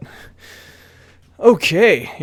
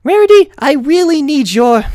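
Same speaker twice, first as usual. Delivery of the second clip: higher, earnest pony voice; young pony heroine; 11 semitones higher, 4 dB louder than usual. A narrator's reading. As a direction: voice the bookish pony. Twilight's voice.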